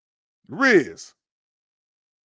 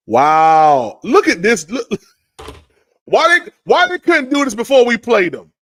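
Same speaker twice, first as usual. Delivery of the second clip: shocked outburst; 9 semitones higher, 13 dB louder than usual. Real, not acted. Shocked reaction to trade news.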